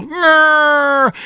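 high revving ambulance growl; siren growl. Acted. Girl racing the hurt cherry away, ambulance growl. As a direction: rumble like a toy ambulance.

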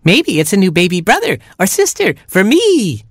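put-on high, hopeful baby chick; eager chick. Baby chick hoping for a sibling, high cry. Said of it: "cried Baby Chick".

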